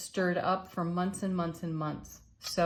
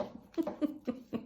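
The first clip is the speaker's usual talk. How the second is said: light high giggle; 8 semitones higher, 7 dB quieter than usual. Real, not acted. Laughs after drawing card.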